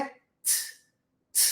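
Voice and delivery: t said as a crisp t; English T. Shown as a demonstration. Teacher demonstrating the English T, crisp t. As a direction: tap the t, never a ti. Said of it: "A pronúncia do T em inglês é".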